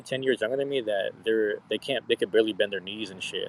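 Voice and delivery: ordinary talk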